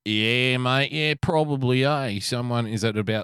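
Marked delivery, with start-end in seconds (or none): 0.0-2.2 Aussie lifeguard. Retold or imitated. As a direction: lightly put on a laid-back Aussie lifeguard drawl. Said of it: "stereotype of how an Aussie lifeguard sounds".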